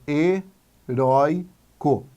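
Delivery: "heróico" slowly broken into he, rói, co; separated into syllables. Shown as a demonstration. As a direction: draw out "heróico" one syllable at a time. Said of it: "Vamos separar em sílabas". ("heróico" devagar, sílaba por sílaba)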